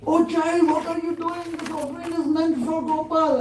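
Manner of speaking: high, loud startled cry; shocked exclamation. Acted. Sadhu startled at the child eating Gopal's offering, loud and high. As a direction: caught the child in the act, exclaim.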